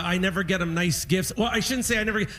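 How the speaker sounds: ordinary talk, nothing marked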